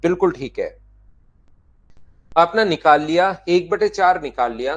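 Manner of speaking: teacher loudly mimics a pupil answering sir; imitating a student. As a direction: play the student who is sure he's right. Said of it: "आप कहोगे".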